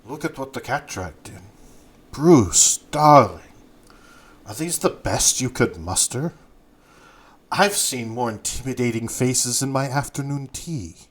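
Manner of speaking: put-on haughty madame with a Mandarin accent. Madame sizing up Bruce's crew, in a Mandarin accent. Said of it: "in her thick Mandarin accent".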